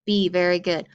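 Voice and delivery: normal talking voice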